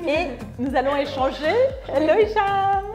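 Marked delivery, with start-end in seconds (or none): 1.9-2.8 high, bright singsong hello; cheery high-pitched hello; calling out a greeting. Real, not acted. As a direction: greet a friend joining the call, voice lifted. Greeting guest who joins remotely.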